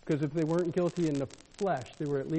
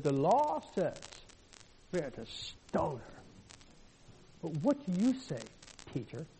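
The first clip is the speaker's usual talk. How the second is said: sarcastic; 2 semitones lower, 6 dB quieter than usual. Retold or imitated. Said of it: "You can almost hear the sarcasm in there".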